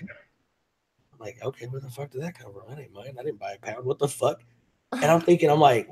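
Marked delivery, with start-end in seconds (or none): 0.0-4.4 muttered confusion. Retold or imitated. Puzzled about found pound.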